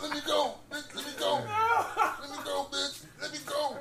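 high strained plea through laughter; choked, pleading. Retold or imitated. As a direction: beg to be let go while held. Acting out being choked.

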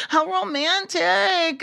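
high, slow dreamy swoon. Acted. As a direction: sigh it like a romantic. Swooning at the story.

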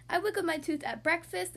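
usual speaking voice

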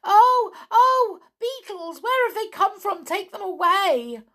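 very high, loud panicked cries; frightened yell. A narrator's reading. Amelia Jane sees the beetles, frightened yell. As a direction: yell in alarm at a horrible sight. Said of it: "she gave such a yell".

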